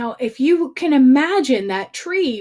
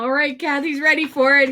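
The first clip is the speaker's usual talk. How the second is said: ordinary delivery, nothing marked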